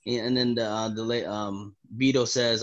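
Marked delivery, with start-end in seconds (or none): none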